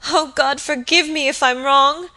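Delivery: high, loud, anguished prayer; gasped plea. A narrator's reading. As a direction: plead with God in distress. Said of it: "gasped in detached sentences".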